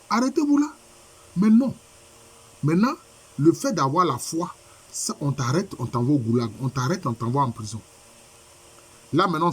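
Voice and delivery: ordinary delivery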